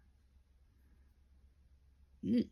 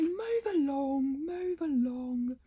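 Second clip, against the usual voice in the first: singsong move along; 5 semitones higher, 5 dB louder, much slower than usual. Acted. Shooing herself onward.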